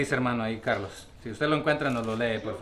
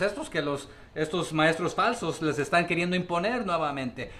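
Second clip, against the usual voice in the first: normal talking voice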